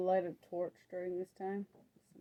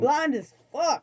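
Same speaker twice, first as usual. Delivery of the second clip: loud teasing shout; 8 semitones higher, 10 dB louder, slower than usual. Real, not acted. Teasing the blind character.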